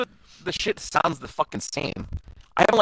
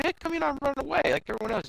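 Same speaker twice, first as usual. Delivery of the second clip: high quick quote; 11 semitones higher, 7 dB quieter, faster than usual. Retold or imitated. Voiced character in retold anime.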